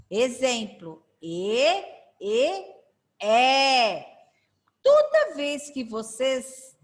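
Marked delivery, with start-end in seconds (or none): 1.2-1.6 "E" com som fechado, ê. Shown as a demonstration. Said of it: "pode ser fechado ou aberto". 2.2-2.6 "E" closed, like ê; E fechado. Shown as a demonstration. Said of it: "pode ser fechado ou aberto". 3.2-4.1 "É" open and loud; a long, loud open "É"; E aberto. Shown as a demonstration. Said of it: "fechado ou aberto".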